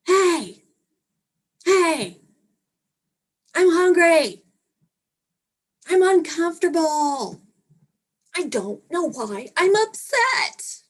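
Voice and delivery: high, loud whining at the door; whiny kid voice. Pretend. Parent getting revenge by waking the kid, loud whine.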